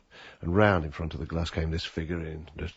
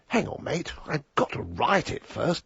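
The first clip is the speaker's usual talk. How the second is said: higher-pitched imitation of an affronted writer; retold protest, as if the listener is at fault; blame-shifting indignation; 8 semitones higher than usual. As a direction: push the blame back, pitched up. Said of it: "the way he said it, it was my fault".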